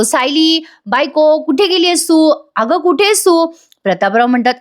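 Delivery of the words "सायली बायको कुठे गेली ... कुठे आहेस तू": higher-pitched mild mock yell; calling out. A narrator's reading. Husband calling for his missing wife, mild narrated yell. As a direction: call out for someone missing, raised pitch. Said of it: "बाहेरून आता अर्जुन ओरडत असतो".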